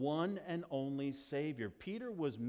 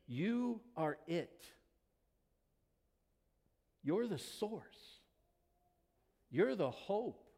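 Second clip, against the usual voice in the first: preaching; 6 semitones higher than usual. Acted. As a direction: let each title land in the silence. Preaching Peter's imagined words.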